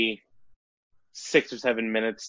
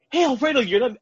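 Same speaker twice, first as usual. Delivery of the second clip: high, sharp call of alarm, much higher and louder; alarmed shout; 4 dB louder, much faster than usual. Retold or imitated. Wife in the pool warning him, alarmed high cry. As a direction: yell his name in a panic. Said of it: "my wife is like".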